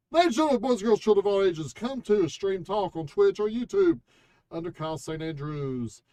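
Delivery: loud, fast ringmaster intro; grand entrance announcement. Pretend. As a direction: announce it like a showman. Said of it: "You're so unprofessional".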